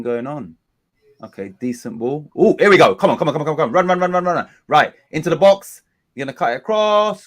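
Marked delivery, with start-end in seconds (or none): 2.4-7.3 loud, high urging; excited commentary. Real, not acted. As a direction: shout them on as the attack builds. Excited live commentary on attack.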